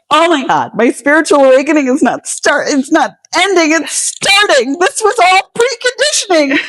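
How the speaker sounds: high, near-tearful mock wail; distraught. Poet retelling her reaction to a cancer diagnosis, high mock wail. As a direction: cry it out in high-pitched, overwhelmed exasperation. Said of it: "And I was like".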